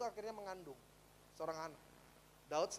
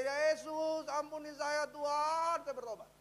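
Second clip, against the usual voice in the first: higher, slow pleading for forgiveness; tearful prayer; 7 semitones higher, 5 dB louder, slower than usual. Retold or imitated. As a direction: pray it out as a repentant plea. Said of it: "dia nangis di tempat tidur". (doa tobat yang memelas, tinggi dan pelan)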